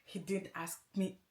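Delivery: ordinary talk, nothing marked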